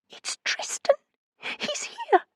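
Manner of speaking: high, broken, near sobbing; crying friend says who's come; tearful. A narrator's reading. Frightened caller sobbing.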